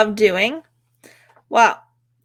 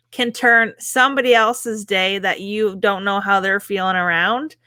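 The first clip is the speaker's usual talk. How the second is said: usual speaking voice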